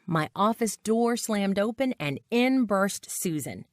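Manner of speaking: usual speaking voice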